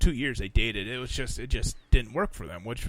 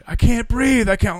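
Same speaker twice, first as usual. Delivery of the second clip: high, loud mock panting plea; imitated frantic cry; 8 semitones higher, 9 dB louder than usual. Imitating mom overheard in bed, mock frantic. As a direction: exclaim it urgently, imitating.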